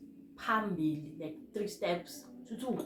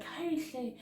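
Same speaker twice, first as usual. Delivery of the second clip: high-pitched imitated reaction; friend's exclamation; 9 semitones higher, 4 dB quieter than usual. Friend reacts on seeing her, high imitated exclamation. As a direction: pitch it up like a taken-aback friend.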